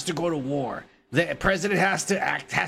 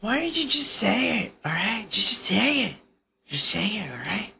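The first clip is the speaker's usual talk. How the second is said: higher, pushy Trump voice; mock Trump, pitched up; bad Trump impression; 4 semitones higher than usual. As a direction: needle the reporter like Trump. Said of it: "My, my Trump is bad".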